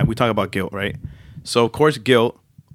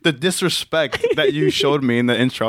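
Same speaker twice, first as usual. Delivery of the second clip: indignant; 6 semitones higher than usual. Retold or imitated. Retelling own indignant reaction.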